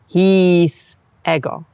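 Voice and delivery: "His ego" slow, "ego" long-short-short; metrical Latin. Shown as a demonstration. Teacher modelling Latin meter, slow. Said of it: "pronounce the long vowels as long".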